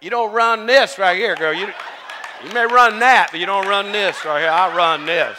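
loud, punchy mock authority; teasing, forceful who's-boss scolding; playful telling-off. Real, not acted. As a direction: lay down the law jokingly. Playfully scolds person on stage.

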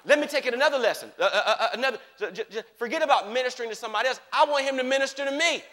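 ordinary talk

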